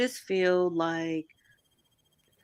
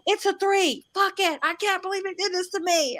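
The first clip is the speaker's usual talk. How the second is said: high-pitched mock outrage; hammed-up indignant reviewer rant; 11 semitones higher than usual. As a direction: imitate upset readers in a high wail. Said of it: "so many people being like".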